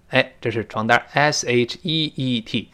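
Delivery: normal talking voice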